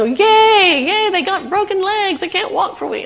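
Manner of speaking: high, loud silly cheering; mock cheer. Preacher mocking a wrong reading of thankful, high silly cheer. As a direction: cheer like a fool at bad news. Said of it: "and I go".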